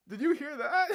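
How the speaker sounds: high, loud laughing question; incredulous laughing. Real, not acted. Asking viewers if they heard her old voice, incredulous squeal.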